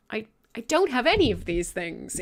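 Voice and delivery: ordinary talk, nothing marked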